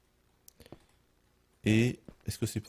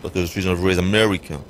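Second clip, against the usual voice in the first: mock boast; 2 semitones lower, 6 dB louder, faster than usual. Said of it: "C'est peut-être mon accent".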